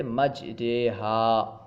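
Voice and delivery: "مجریہا" slowly, with aa bent toward a long ay; tilted vowel (imala). Shown as a demonstration. Teacher demonstrates imala, "مجریہا" drawn out with tilted vowel. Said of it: "الف کو یا کی طرف مائل کر کے".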